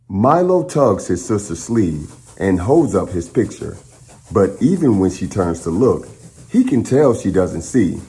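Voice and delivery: put-on steady, clear read-aloud voice for children; story narration. Host reading a picture book to the class, in a clear storytelling voice.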